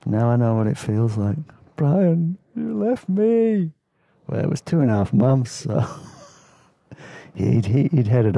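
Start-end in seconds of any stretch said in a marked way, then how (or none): 1.8-3.7 mock heartbroken. Mock lament at friend leaving.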